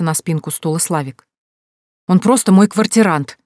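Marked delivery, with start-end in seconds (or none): 2.1-3.4 вспыльчиво, громко и выше; резко вспыхни в ответ; вспыхнула. A narrator's reading. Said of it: "вспыхнула Крош". (Женя резко вспыхивает, защищаясь от брата)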